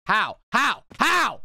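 escalating high, loud cries of HOW; frustrated, punched-out repeats; exasperated disbelief. Real, not acted. Failing again in game.

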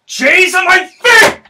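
high, loud, drawn-out shout; hammy stage actor. Acted. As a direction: belt the hook like a ham actor. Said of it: "repeated hook, shouted take".

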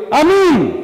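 loud, high shouted amen; crowd amen shout. Acted. The tribes answer the curse with a loud high amen. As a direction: roar it like thousands answering together. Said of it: "Και κάνουν όλοι".

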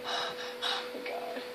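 breathless frightened whisper. Real, not acted. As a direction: whisper it in fear.

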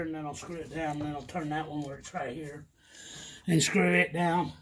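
ordinary talk

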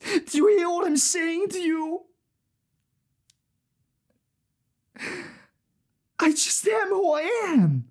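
high, strained, tearful plea; anguished confession. Acted. Failure confessing a buck an hour, anguished plea. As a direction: plead to be understood, voice pitched high.

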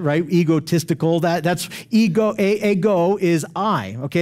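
usual speaking voice

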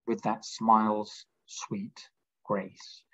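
slow, breathy whisper of the line; whispered verse. Acted. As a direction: let the line fall to a hush. Reciting the tender poem line.

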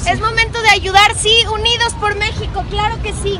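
high, lifted rallying cry; rallying call. Real, not acted. Rallying neighbours to help the victims, high and lifted. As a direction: fire up the crowd to help.

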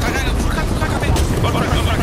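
exulte fort et aigu; excité. Real, not acted. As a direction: crie la victoire, fort. Exulte après le crack. (excité)

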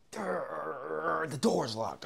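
high-pitched mock straining at a door; hammed-up struggle. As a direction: act out tugging at a stuck door. Acting out the locked-door scene.

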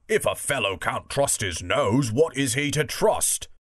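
lightly voiced bulldog; a hint of a bulldog arguing. Bulldog defending his nose to the elephant, lightly voiced bulldog. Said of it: "said the bulldog".

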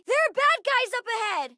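high, urgent call-out; high-pitched cry of alarm; alarmed warning. Acted. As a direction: warn them of danger, voice way up. Warning of enemies.

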